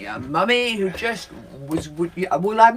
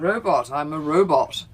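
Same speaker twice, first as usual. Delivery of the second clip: lightly voiced robot; 4 semitones lower, slower than usual. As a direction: say it like a stiff robot. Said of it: "I'm doing a robot action".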